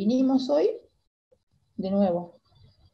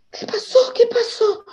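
high, crying panicked question; frantic mother; 13 semitones higher, 4 dB louder than usual. Retold or imitated. Frantic mother reaching her hurt daughter, high crying panic. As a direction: ask in tearful panic.

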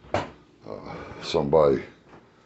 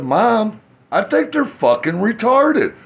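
retold exclamation; 7 dB louder than usual. Retold line to mom.

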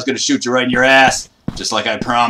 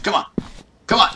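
ordinary delivery

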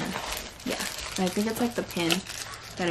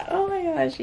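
excited; 10 semitones higher than usual. Real, not acted. Overjoyed over pillowcase.

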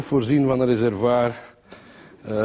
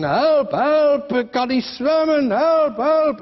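high, loud mock cry for help; cries for help; 12 semitones higher, 5 dB louder, much slower than usual. A drowning man in the pond cries out for help.

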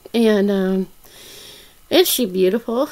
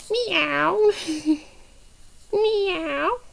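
high, drawn-out mew; imitated meow; 5 semitones higher than usual. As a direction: mimic a calling kitten. Imitates a cat.

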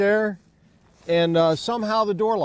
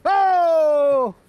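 surprised exclamations; 9 semitones higher, 3 dB louder than usual. Real, not acted. Door pops open.

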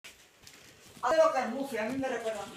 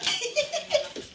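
high laugh; 14 semitones higher, 5 dB quieter than usual. Real, not acted. Laughing at dog with balloon.

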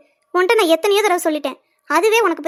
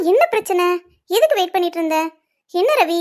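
normal talking voice

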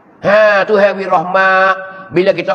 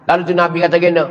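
ordinary talk

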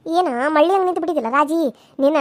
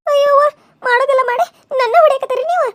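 loud, high wailing cry; crying out in pain; 6 semitones higher, 6 dB louder than usual. Acted. Beaten wife crying out at husband, loud wail. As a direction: wail as the blows land.

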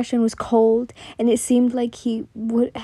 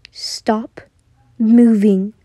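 threatening whisper; 7 dB louder, much slower than usual. Acted. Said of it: "he threatened as he whispered".

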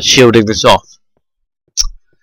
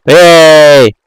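loud happy yell; excited shout; 5 semitones higher, 6 dB louder than usual. Real, not acted. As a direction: shout it out in delight. Finds diamond, shouts.

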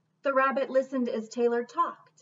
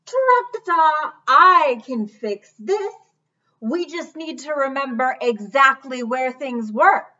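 elephant voice; 2 semitones higher, 9 dB louder than usual. Acted. Elephant offers Taylor a fix, loud and confident. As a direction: trumpet first, then sound sure of the plan.

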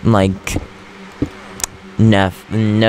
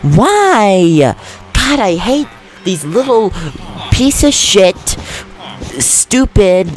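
angry rant; 8 semitones higher than usual. Real, not acted. Player ranting at creepers that wrecked the room, furious rant. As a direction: rage at the little green pests.

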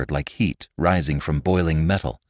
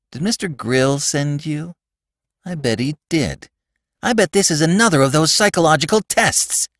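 put-on higher-pitched accusing boy; angry suspicion; 8 semitones higher than usual. A narrator's reading. Robbie suspecting another psychological test, angrily accusing. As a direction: accuse him, rising to indignation. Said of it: "Robbie flushed with dark anger".

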